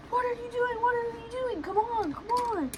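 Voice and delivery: high, thin-volume mock panic; retold frantic urging. Calling shopping friend to shelter, retold frantic plea. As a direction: plead from afar in a high voice.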